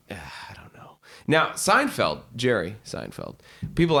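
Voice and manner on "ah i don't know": breathy whispered ah, I don't know; whispered shrug. Retold or imitated. Narrator shrugging off beggars after Belgrade, whispered. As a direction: let it out in a weary whisper. Weary dismissal.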